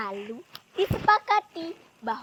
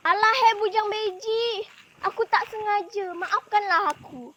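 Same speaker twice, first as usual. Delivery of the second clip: high, whiny excuse, easing toward the end; acted high-pitched plea; pleading apology; 6 semitones higher than usual. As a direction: beg off blame from your rival. Culprit apologises to rival.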